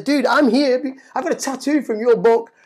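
high, loud, gushing fan rush; starstruck excitement. Retold or imitated. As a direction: blurt it out to your hero, thrilled. Meeting his hero by the pool.

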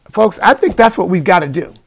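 ordinary talk, nothing marked

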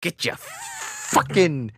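slow, high angry drawl; hammed-up frustration; mock rage.

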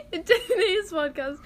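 fighting off laughter; words shaking with giggles; laughing. Real, not acted. Cracking up mid-intro.

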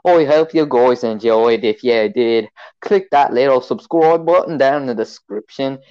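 excitable mock British-Australian; British-Australian accent. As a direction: sign off as a pretend matey Brit. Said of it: "a mix between".

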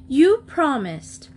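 high, loud, drawn-out reproach; accusing reminder. A narrator's reading. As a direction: stretch it out accusingly. Marcia accuses Geneva of backing out.

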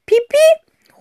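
put-on high squeaky children's call; childish shout. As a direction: squeal it like giggling kids. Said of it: "rufen die Geschwister lachend". (Die Zwillinge rufen laut und kichernd)

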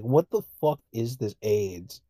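ordinary delivery

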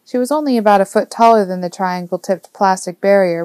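plain reading voice

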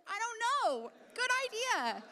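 high-pitched comic shrug of a reply; mock breezy reply. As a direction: toss it out high, as if it's obvious. Playful reply to the eunuch.

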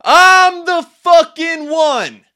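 huge high scream, every word stretched. Retold or imitated. Retelling the bodybuilder's morning ritual, screamed. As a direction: bellow it slowly at the top of your voice. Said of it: "and he screamed".

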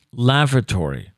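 "lavatory" in a mock upper-class voice; "lavatory" said with a mock-posh lilt; posh pronunciation. Shown as a demonstration. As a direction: say "lavatory" like a grand lady.

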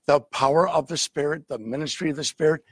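usual speaking voice

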